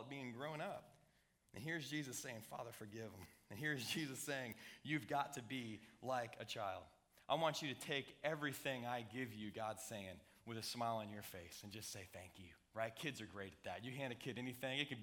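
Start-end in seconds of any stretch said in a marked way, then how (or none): 2.4-3.3 much softer, lower and hushed; tender prayer. Acted. Jesus on the cross asking the Father to forgive, tenderly hushed. 4.8-12.5 lower, softer, slowing down; gentle fatherly voice. Acted. God telling his children to receive with thanks, softly.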